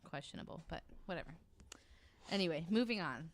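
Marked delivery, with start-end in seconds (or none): 0.0-1.3 dry aside. Real, not acted. Dry aside after criticism.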